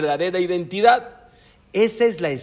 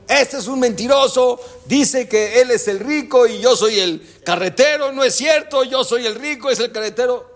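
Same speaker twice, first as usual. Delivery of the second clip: mock protest; 7 semitones higher than usual. Driver accuses before the rabbi.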